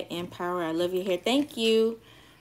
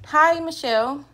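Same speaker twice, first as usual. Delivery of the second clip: ordinary talk, nothing marked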